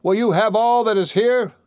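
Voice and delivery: high-pitched storybook bawl. A narrator's reading. Tom calls from the parson's room to the thieves, bawling. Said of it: "called out as loud as he could bawl".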